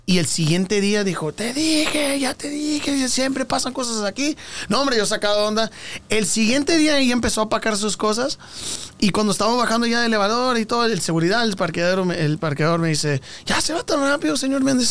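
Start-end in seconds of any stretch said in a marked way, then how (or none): 1.3-4.4 high-pitched mock "I told you so"; imitated scolding woman. Retelling his ex scolding him next day, mock high scolding. 13.5-14.9 high-pitched questioning voice; imitated parking attendant. Parking attendant asks the departing tenant, imitated high voice.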